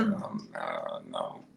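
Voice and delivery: very low, soft crow voice refusing; a low grumbling crow saying no. Acted.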